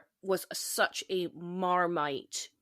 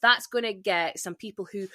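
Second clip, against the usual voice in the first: ordinary talk, nothing marked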